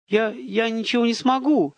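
high, stammered helpless protest; high-pitched bewildered excuse; flustered. A narrator's reading. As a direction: stumble over the words, at a loss. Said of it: "растерялся Владимир Петрович".

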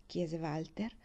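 ordinary delivery, nothing marked

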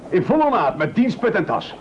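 high, eager cry of discovery; excited spotting. Acted. The chef spots the swimmer, excitedly high. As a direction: point him out with high excitement.

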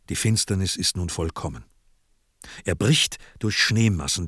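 usual speaking voice

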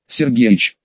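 ordinary delivery, nothing marked

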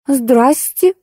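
a bewildered hello to an unexpected visitor; puzzled exclamation. A narrator's reading. Clerk startled to see the visitor, surprised. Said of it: "недоуменно воскликнула она".

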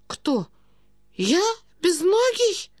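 high-pitched, drawn-out disbelief; shocked old prince. A narrator's reading. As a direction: question the insult in wounded astonishment. Prince stunned by the insult. (князь, высокое изумлённое переспрашивание)

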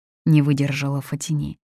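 ordinary delivery

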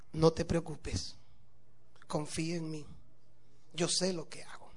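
calm her fears gently, almost whispering; calm reassurance. Acted. Husband's calm reassuring refrain.

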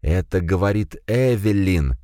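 slow, carefully clear words. A narrator's reading. Evelyn speaking slowly into the head's microphone. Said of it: "чётко и медленно сказала женщина".

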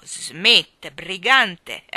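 very loud, hearty mock accusation; jovial scolding. A narrator's reading. Mayor teasing the park keeper, jovial scolding. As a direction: boom it out like delighted blame.

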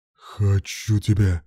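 slow, restrained growl; growled desire. A narrator's reading. Man declares his desire to his partner, growled desire. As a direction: growl it slowly with hunger. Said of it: "прорычал Руслан".

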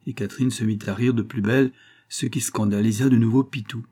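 usual speaking voice

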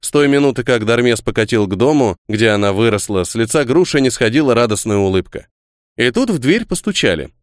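plain reading voice

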